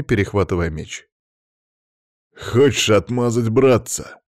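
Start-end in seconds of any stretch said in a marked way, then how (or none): 2.3-4.2 louder, tight-jawed menace; a tense, seething challenge; through clenched teeth. A narrator's reading. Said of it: "процедил Павел".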